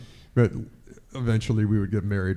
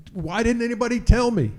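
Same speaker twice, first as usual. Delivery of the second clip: exasperated plea; 11 semitones higher than usual. Retold or imitated. Fired reporter's plea.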